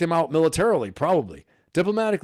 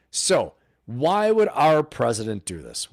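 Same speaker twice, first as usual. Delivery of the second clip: ordinary delivery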